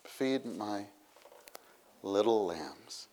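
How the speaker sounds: soft, slow, tender; softly spoken charge to care for lambs; tender quote. Retold or imitated. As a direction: lovingly hand over the charge. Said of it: "three different things he says".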